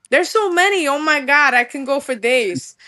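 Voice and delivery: usual speaking voice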